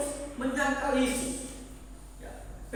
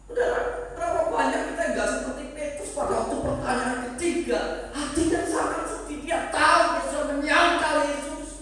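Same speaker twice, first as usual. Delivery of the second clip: louder, building passionate sermon; impassioned preaching; 5 semitones higher, 7 dB louder than usual. Acted. Preacher urging the congregation to repent, fervent and loud. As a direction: drive the plea home with rising force.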